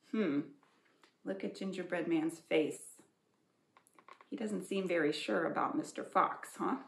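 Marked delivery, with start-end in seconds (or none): none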